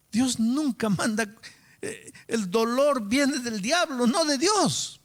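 high, forceful preaching with drawn-out words; emphatic preaching. Real, not acted. Preacher correcting who sends pain, emphatic. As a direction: proclaim it high and firm. Preacher refutes blaming God.

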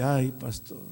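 ordinary delivery

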